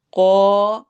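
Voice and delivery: teacher models قو with a thick qaaf and long vowel; heavy qaaf with rounded lips. Shown as a demonstration. Teacher demonstrating قو, heavy and drawn out. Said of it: "قاف کو موٹا پڑھنا ہے".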